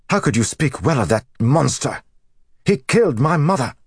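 high, loud, impassioned protest; anguished anger, voice climbing; outraged accusation. A narrator's reading. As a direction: hurl the accusation with a son's fury. Outraged son accuses mother's supposed killer.

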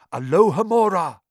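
plain reading voice

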